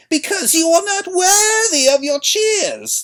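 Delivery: put-on high, loud, slow old woman's voice; old crone accusing. A narrator's reading. Old woman denouncing the queen in a high, loud, slow voice. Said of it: "the old woman said".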